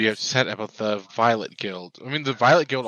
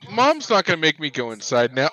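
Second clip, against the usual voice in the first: cheeky dog voice; 6 semitones higher, 7 dB louder than usual. Pretend. Voicing the dog.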